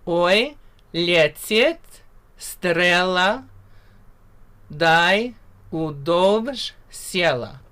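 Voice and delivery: Oi, letit strela said very slowly and clearly; slow pronunciation demo. Shown as a demonstration. Teacher repeats the line slowly for students. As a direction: draw out Dai udovj siela slowly. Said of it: "I'll say that one more time".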